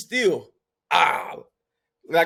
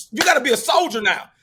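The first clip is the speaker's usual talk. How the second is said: tough dad command; 8 semitones higher, 5 dB louder than usual. Retold or imitated. Dad yelling at son in traffic.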